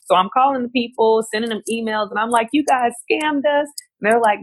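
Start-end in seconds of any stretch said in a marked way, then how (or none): 2.5-3.7 accusing. Retold or imitated. Accusing the visa company on phone.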